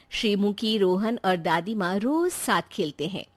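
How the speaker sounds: plain reading voice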